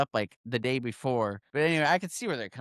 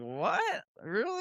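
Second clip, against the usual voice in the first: much higher, quieter what, really; incredulous, pitched way up but soft; retold disbelief; 10 semitones higher, 5 dB quieter, slower than usual. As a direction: mimic someone who can't believe the question. Processor surprised at sheriff's call.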